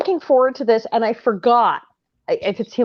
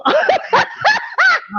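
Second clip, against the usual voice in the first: high shrieking laugh. Real, not acted. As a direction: lose it in a shriek. Guest laughing hard.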